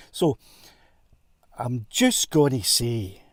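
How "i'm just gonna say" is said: ordinary delivery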